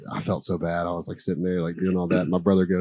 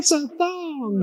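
very high, drawn-out cartoon squeal; Shrek character impression; much slower than usual. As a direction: stretch it out in a tiny high voice. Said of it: "That kind of thing".

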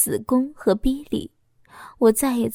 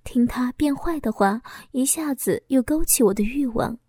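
ordinary delivery, nothing marked

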